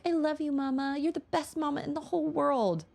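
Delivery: put-on high, sugary child adoration; angelic child voice. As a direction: coo it like a loving toddler. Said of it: "like, angelic".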